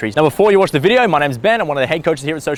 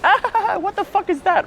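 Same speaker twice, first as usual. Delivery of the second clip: mock baffled squeal, much higher; imitating French girl's surprise. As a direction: play the girl, startled and amused, pitched way up. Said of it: "instantly they're like".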